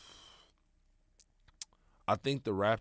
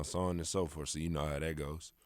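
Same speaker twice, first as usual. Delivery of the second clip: ordinary talk, nothing marked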